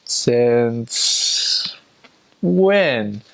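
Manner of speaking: drawn out, rising on "when"; mock puzzled. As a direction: play up the confused question. Mock puzzled reply to the phrase.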